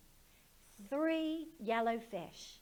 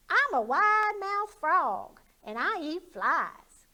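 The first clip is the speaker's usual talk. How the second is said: high, loud, slow frog voice; proud little frog showing off; silly high frog boasting; boastful frog; 9 semitones higher, 12 dB louder than usual. Acted. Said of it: "said the wide-mouthed frog".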